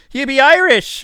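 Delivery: high, loud mock-Irish exclamation; mock Irish accent. Critics challenging the streamer about being Irish, mock Irish accent. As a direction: blurt it in a hammed-up Irish accent. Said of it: "I've been told my Irish accent is horrible. They're like".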